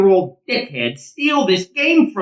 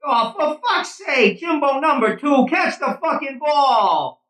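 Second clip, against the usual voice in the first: yelling; 7 semitones higher than usual. Acted. Yelling at fielder.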